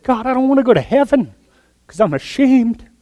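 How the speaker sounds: high, strained cry of shame; preacher voicing an ashamed missionary's high cry; anguished plea. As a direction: plead to God, overwhelmed. Preacher voices Washer crying out.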